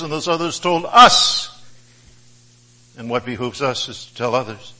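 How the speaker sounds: usual speaking voice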